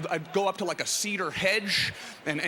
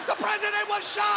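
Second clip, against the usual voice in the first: put-on high, loud panicked kid shout; running shout; 3 dB louder than usual. Kid running past hedges, high panicked shouting. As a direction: yell it while running around. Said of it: "now I'm running".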